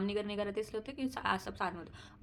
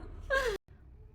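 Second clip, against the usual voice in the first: high laugh. Real, not acted. Laughing after mock scold.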